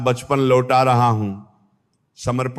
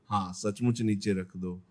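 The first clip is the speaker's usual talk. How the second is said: much softer, lower voice; hushed urging; 5 semitones lower, 11 dB quieter than usual. Real, not acted. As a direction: drop to a soft, intimate reassurance. Intimate repeat urging to let go.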